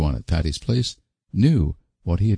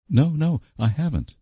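gasping; 10 semitones higher than usual. A narrator's reading. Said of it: "gasped poor Anne".

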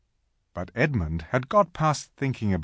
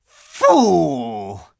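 loud, drawn-out witch's snarl; savage near-snarl; 5 semitones higher, 9 dB louder than usual. A narrator's reading. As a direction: spit it out with savage contempt. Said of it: "a savage smile that was almost a snarl".

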